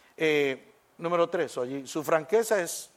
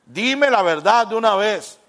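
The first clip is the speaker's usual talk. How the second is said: louder, insistent demand for truth; blunt demand; 4 semitones higher, 9 dB louder than usual. Acted. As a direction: push them to just say it. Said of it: "le gusta". (exigiendo la verdad, más fuerte)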